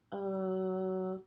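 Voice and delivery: steady sung tone to match; held pitch. Shown as a demonstration. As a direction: hold one note. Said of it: "I'm gonna say".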